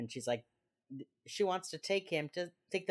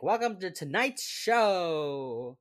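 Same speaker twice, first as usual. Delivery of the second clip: slow, loud show-host greeting; 3 semitones higher, 5 dB louder, much slower than usual. Retold or imitated. Man on the hacked camera greets his viewer, show-host voice. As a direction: draw it out like an announcer.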